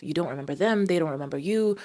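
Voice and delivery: normal talking voice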